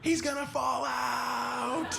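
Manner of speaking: high, drawn-out mock wail; imitated girlish scream. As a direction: squeal it in put-on alarm, stretching the words. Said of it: "polite, quiet scream that girls do".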